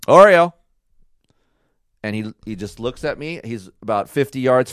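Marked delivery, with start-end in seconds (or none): 0.1-0.5 one loud, higher call; short, strong call with falling tune; calling the dog. Shown as a demonstration. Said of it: "I just did this".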